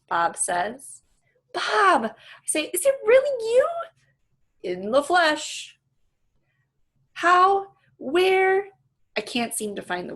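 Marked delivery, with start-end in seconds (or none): 1.5-2.1 high, loud burst of joy; surprised cry. Acted. Ivan recognizing Bob, surprised joyful cry. 2.7-3.7 high, loud disbelieving question; amazed. Acted. Ivan greeting his old friend Bob, amazed. 4.6-5.8 slow, drawn-out smug reply; smug dog voice. Acted. Bob answering Ivan, smug and slow. 7.1-8.7 high, loud stunned questions. Acted. Ivan unable to find words, stunned.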